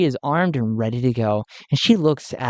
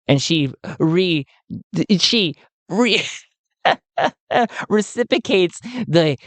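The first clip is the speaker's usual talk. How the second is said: usual speaking voice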